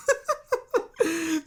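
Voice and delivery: real burst of high laughter. Real, not acted. Laughing at his own embarrassing hoodie story, real laugh.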